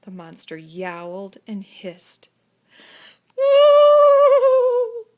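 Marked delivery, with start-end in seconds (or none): 3.4-5.1 very high, very loud wailing ooh; sobbing wail. A narrator's reading. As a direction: wail in terror. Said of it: "sobbed the dogs".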